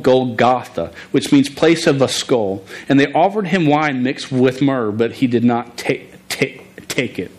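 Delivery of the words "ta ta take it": stuttered start on take; real stutter. Real, not acted. Stuttering guy reading the crucifixion to the congregation, stutter. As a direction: repeat the first syllable before the word comes out. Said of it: "A st-, stuttering guy".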